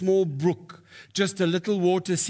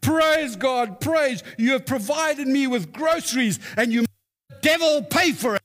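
high, exuberant shout of thanks; hammed-up old lady rejoicing skyward; acted jubilant praise; 8 semitones higher, 3 dB louder than usual. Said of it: "threw her hands up in the air".

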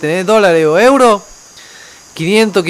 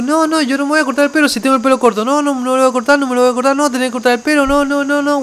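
higher-pitched, rapid-fire back-and-forth refusals; retold bickering; 7 semitones higher, faster than usual. Retelling cook and employee arguing over a haircut, higher insistent protest. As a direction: re-enact a stubborn squabble, pitched up.

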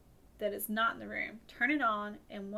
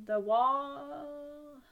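spooky voice; 5 semitones higher, 5 dB louder than usual. Acted. Spooky misread, then just kidding.

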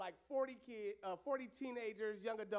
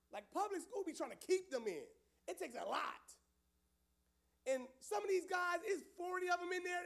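normal talking voice

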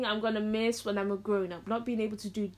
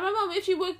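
ordinary talk, nothing marked

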